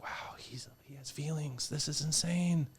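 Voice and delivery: hushed wow rising to high exclamation; amazed people. Retold or imitated. Retelling audience's amazement at his feelings, mock astonished. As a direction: imitate astonishment, starting breathy then climbing. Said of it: "now people are like".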